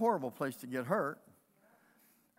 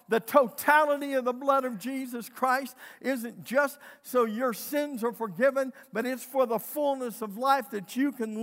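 raised, higher preaching voice; 5 semitones higher, 6 dB louder than usual. Acted. Pastor preaching to the congregation, rising proclamation. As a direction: proclaim the blood of Jesus.

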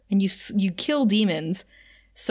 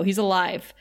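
ordinary delivery

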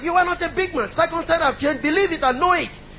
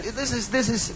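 ordinary talk, nothing marked

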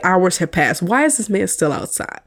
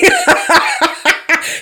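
high, loud giggling burst; burst of laughter; 4 dB louder than usual. Real, not acted. Host cracking up at her Michael Scott comparison, high laugh. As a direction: crack up at your own joke.

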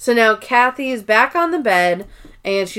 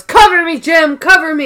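loud, high battle cry; mock heroic yell; urgent shout; 8 semitones higher, 7 dB louder than usual. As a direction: call out like a soldier under fire. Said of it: "he goes".

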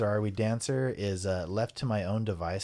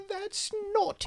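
very high, slow prim queen; old queen voice; slower than usual. Pretend. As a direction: squeak it like a shocked old queen. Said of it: "the queen's like".

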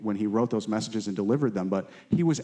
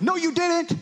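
mocking denial voice; 3 dB louder, slower than usual. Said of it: "not that's like".

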